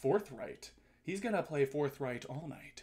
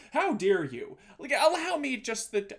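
normal talking voice

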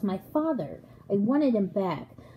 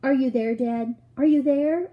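louder, higher searching call; raised, drawn-on call for Dad; shouting; 5 semitones higher, 5 dB louder than usual. A narrator's reading. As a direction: call into the distance, building on the repeat. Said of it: "I shouted".

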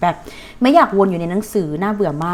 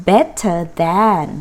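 better than stretched out slowly; drawn-out teacher model of better than, stress on better; slow model phrase; 3 semitones lower, much slower than usual. Shown as a demonstration. Teacher models the phrase for students, slowly. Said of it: "ดีกว่าก็คือใช้อะไรนะ".

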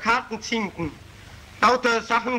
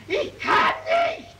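"Ich kann nicht" cried out much higher, slow and breathless; strangled scream; slower than usual. Acted. Murderer breaking down, high strangled cry. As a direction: push it out as a voiceless shriek.

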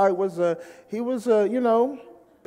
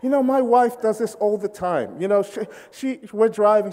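usual speaking voice

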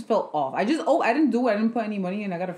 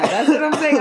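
laughing; 7 semitones higher, 9 dB louder than usual. Real, not acted. Excited agreement, laughing.

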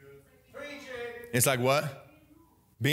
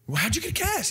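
much higher, startled question; acted shock; 12 semitones higher, faster than usual. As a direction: ask in exaggerated alarm. Retells shocked question to child.

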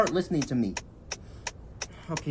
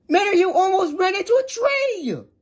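high, exasperated telling-off; indignant outburst after a scare; angry scolding; 7 semitones higher than usual. Acted. Scolding after near crash.

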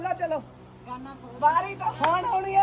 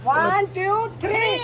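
loud, higher count, rising at the end; calling a countdown; 4 semitones higher, 4 dB louder, much slower than usual. Real, not acted. Host counting players into the ring game, calling out. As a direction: call out the start of a game.

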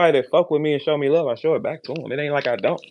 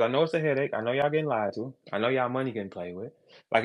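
normal talking voice

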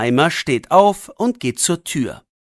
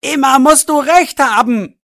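high, loud angry cry; angry shout; 8 semitones higher, 4 dB louder than usual. A narrator's reading. Max shouting at his friend in anger. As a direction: shout it at him, fed up. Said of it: "ruft er".

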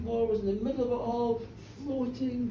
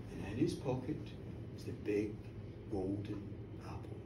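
hushed reveal; 11 dB quieter than usual. A narrator's reading. Narrator reveals the golden apple, hushed. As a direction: drop the voice for the reveal.

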